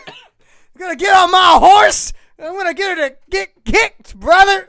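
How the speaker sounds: loud, high Southern hillbilly shouting through laughs. Acted. Starfire boasts in a laughing Southern hillbilly holler. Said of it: "the hillbilly voice".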